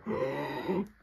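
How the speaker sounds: soft gasp for scarce air; imitated gasp. Man recounting huddling in a corner, imitated soft gasp. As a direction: act out saving every breath. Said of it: "I'm just like".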